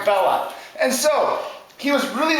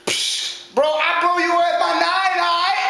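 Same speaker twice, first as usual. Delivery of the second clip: high-pitched bro bravado; scoff, then boast up high; cocky slangy piggy; defiant second pig; 7 semitones higher than usual. Acted. Second pig defies wolf.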